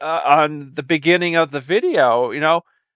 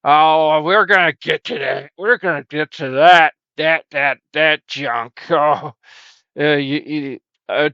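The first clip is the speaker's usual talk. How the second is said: ordinary talk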